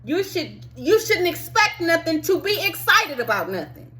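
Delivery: ordinary talk, nothing marked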